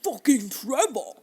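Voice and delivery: loud, slow, rasped name; mock growled curse. Host reprising the jealous ex's grudge, mock growl. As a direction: curse the rival in a drawn-out rasp.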